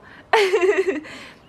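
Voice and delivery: loud, high laughing; high, helpless laugh; real burst of laughter. Real, not acted. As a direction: crack up in disbelief. Laughs at the clip again.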